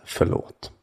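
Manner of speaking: "Förlåt" breathed as a soft whisper, not a shout; a quiet whisper standing in for a remembered call; hushed, tender "Förlåt"; whispered apology. Retold or imitated. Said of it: "klivit ut och ropat".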